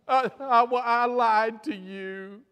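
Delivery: loud, high, drawn-out blubbering; hammed-up tearful confession, wailed slowly; mock weeping. Cab driver admits his lie to the nun, mock sobbing. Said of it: "he just began to weep and cry".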